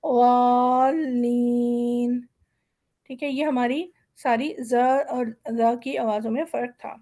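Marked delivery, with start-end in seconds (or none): 0.0-2.2 والین drawn out very slowly and heavily; a slow, heavy والین; very thick sound. Shown as a demonstration. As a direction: stretch والین with a heavy, full letter. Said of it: "واد کی آواز بہت موٹی ہے".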